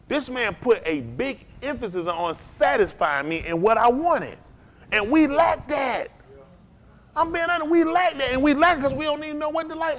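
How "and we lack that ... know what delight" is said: fervent preaching. Real, not acted. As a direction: voice climbs high, pressing the point. Preacher presses the point hard.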